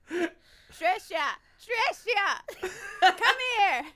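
high, strained put-on call; comic high-pitched summons. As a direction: call out in a high, odd old-father voice. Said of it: "A voice called from the other room".

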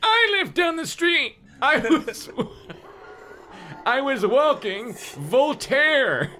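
squeaky big man voice; old man voice, very high; high-pitched voice. Acted. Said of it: "He had a high-pitched voice".

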